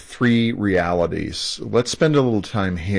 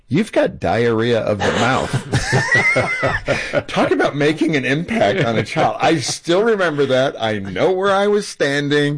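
higher-pitched, talking through real laughter; laughing; 7 semitones higher than usual. Real, not acted. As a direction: laugh as you recall being told off. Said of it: "I'm laughing because".

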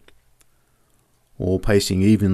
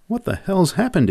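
high, shocked question; startled exclamation; 10 semitones higher than usual. A narrator's reading. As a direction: gasp it out in disbelief. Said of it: "exclaimed Joe".

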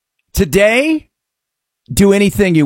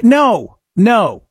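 ordinary delivery

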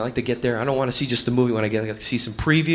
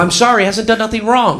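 raised, loud brush-off; higher, louder character voice; unhelpful movie cop; 7 semitones higher, 6 dB louder than usual. Acted. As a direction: play a policeman turning her away. Police brush off the trailer heroine.

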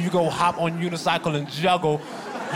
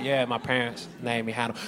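weary deadpan; 5 semitones lower, 7 dB quieter than usual. Retold or imitated. Weary flat answer.

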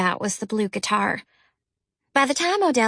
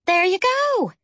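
put-on older sister voice; 10 semitones higher, slower than usual. A narrator's reading. Jackie encourages.

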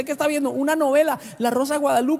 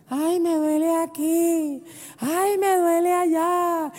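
higher, slow, whiny mock moan of aches; mock complaining wife; 9 semitones higher, much slower than usual. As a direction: ham up a wife moaning about pains. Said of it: "cuando las mujeres empiezan a dar problemas".

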